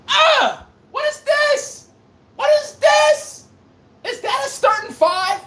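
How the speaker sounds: very high-pitched exasperated yelling; outraged disbelief. Acted. Host appalled at the Celtics' starting five, shrill outraged yelling. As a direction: cry out in hammed-up horrified disbelief.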